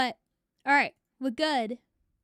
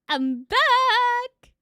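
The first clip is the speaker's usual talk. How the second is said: high, loud, joyful yell; excited shout; 10 semitones higher, 10 dB louder than usual. Real, not acted. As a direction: announce your return triumphantly. Stream returns after crash.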